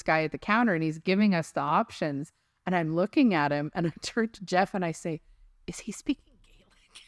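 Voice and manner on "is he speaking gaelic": hushed, unvoiced whisper; whispered aside. Asking husband about the ticket clerk, whispered aside. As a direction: whisper it as a secret question.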